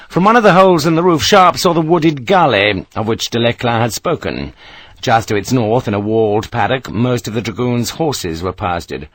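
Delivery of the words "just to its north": plain reading voice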